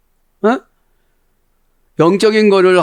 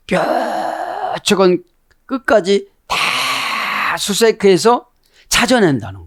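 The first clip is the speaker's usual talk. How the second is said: 뾰족한 stretched long and higher; drawn-out emphasis; 5 semitones higher, much slower than usual. Real, not acted. Preacher describing a search to the very tip, 뾰족한 drawn out emphatically. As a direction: drag out the first syllable of 뾰족한, then search slowly.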